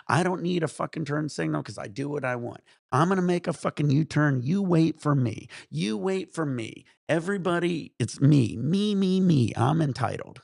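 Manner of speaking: building, loud, insistent self-important parody; mock entitled driver. Storyteller parodies a driver who makes everyone wait, entitled and bossy. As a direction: demand the road as if it were yours, hammed-up. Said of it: "They all think they're entitled".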